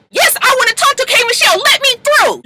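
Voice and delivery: very high, fast and insistent; mock furious mama. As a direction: shove past the guard with a shrill demand. Lyrica arguing with security.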